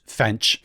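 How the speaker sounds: "Fench" said for fence, an n-ch sound instead of the s; slip of the tongue. Real, not acted. Host tripping on the word fence mid-story, saying "Fench". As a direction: fumble "Fench" with a ch ending. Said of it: "French, my favorite".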